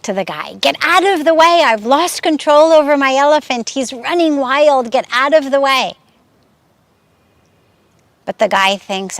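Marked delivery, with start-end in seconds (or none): 0.7-5.9 higher, louder frantic warning; panicked yelling. Retold or imitated. Elephant handler yelling at the disciple, frantic shout. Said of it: "he starts yelling".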